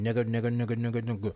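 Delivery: low, soft mocking mutter; mocking the woman grumbling as she walks off, low and soft; imitated muttering. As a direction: mumble it under her breath. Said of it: "I know she was saying".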